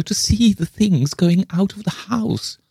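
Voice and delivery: high-pitched plaintive wail. A narrator's reading. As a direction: keep wailing, voice held very high. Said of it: "wailed the other".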